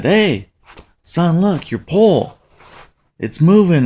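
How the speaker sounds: excited dad calling his son; excited alert. Retold or imitated. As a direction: call out to point at a sudden bite. Father alerts son to bite.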